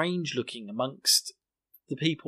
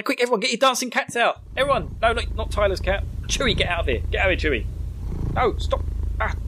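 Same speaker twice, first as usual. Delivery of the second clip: higher-pitched pretend panic; mock frantic calling; 8 semitones higher than usual. Host shooing dancing cats out, mock-frantic calls. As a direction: herd everyone with playful urgency.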